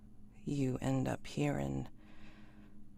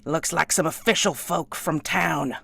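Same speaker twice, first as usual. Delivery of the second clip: loud, raised reply to someone inside; shouting back; 5 semitones higher, 11 dB louder than usual. Acted. Hired man shouting back to the lady inside. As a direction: call back over his shoulder.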